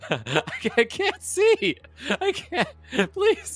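extremely high mock whimper; hammed-up falsetto desperation; pleading victim. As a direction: beg helplessly like a blinded henchman. Blinded henchman pleads for help.

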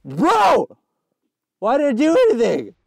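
loud, high outburst at your own mistake; exasperated yell. Real, not acted. Yells at own game mistake.

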